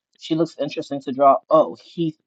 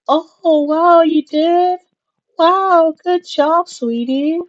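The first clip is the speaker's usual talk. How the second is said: much higher, loud, slow gushing; dad praising toddler; 14 semitones higher, 8 dB louder, much slower than usual. Acted. Dad talking to a four-year-old, exaggerated high praise. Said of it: "inflection in your voice, and it goes a little bit higher".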